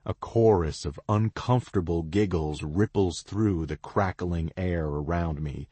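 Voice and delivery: ordinary delivery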